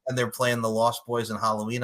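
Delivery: ordinary talk, nothing marked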